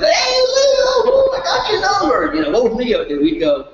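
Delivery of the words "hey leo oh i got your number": very high, thrilled greeting; excited old buddy. Retold or imitated. To Leo after twenty years, a very high thrilled hello. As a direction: burst in like a long-lost college pal. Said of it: "called Leo up".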